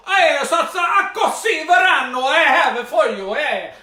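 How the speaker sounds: hammed-up Italian shout of "Accussi Verano", high and laughing; mock Italian. Host clowning the dish name to viewers, mock Italian shout. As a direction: belt "Accussi Verano" like a joking Italian chef. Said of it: "No, that sounds Italian".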